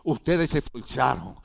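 ordinary talk, nothing marked

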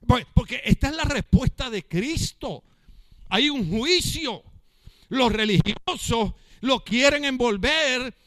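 loud, driving sermon voice; fiery preaching. Acted. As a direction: preach it out to the whole church. Pastor preaching at full force.

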